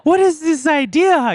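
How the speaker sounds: high, loud, amazed gasp of wonder; awed audience. Retold or imitated. Mimicking the MIT crowd's reaction, loud and amazed. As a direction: play the stunned crowd, wide-eyed. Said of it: "And they're just like".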